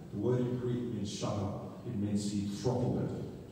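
normal talking voice

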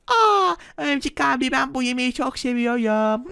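put-on high cute baby voice. Şimşek Bebek thrilled about the food, cute baby voice. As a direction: talk like an excited little kid.

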